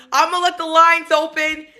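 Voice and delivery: normal talking voice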